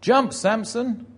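high, loud, drawn-out order; shouted command. Retold or imitated. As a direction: shout it like a heckler in the crowd. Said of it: "somebody shouts".

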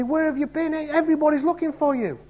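half-scold Jesus for slipping off; mild rebuke. Retold or imitated. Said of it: "a hint of a rebuke".

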